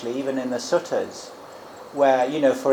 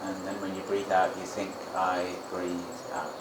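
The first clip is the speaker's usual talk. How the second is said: guided meditation; 5 semitones lower, 9 dB quieter than usual. Shown as a demonstration. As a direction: guide the breath softly and unhurriedly. Leads a breathing meditation.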